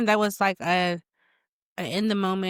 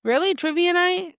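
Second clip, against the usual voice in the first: surprised; 11 semitones higher, 5 dB louder than usual. Real, not acted. Surprised at the trivia night choice.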